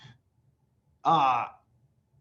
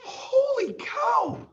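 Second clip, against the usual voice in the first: high, slow stretched yell; ecstatic shout; much slower than usual. Real, not acted. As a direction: shout out in delight.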